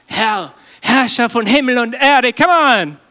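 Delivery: high, loud mock-solemn address to God; pompous prayer. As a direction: ham up a grand formal prayer. Übertriebene feierliche Gebetsanrede. (übertrieben feierliche Gebetsanrede, laut und hoch)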